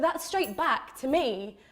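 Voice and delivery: normal talking voice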